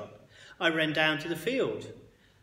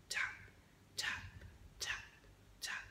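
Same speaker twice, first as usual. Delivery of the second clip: whispered taps; 14 dB quieter than usual. A narrator's reading. Footsteps approaching in silence.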